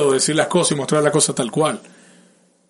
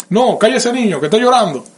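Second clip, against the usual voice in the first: higher-pitched, exasperated order to hush; mock scolding; 6 semitones higher, 3 dB louder than usual. Adult ordering a crying child silenced, mock scolding.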